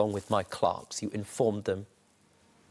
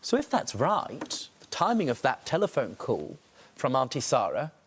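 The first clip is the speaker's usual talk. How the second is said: usual speaking voice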